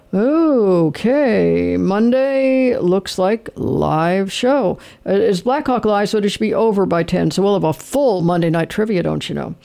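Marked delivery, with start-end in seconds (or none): 0.1-4.8 very slow, stalling as you read it off; slow, drawn-out reading. Real, not acted. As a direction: stretch each word while checking the schedule. Stalling while he reads the schedule.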